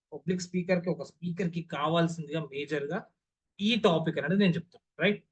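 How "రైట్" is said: ordinary talk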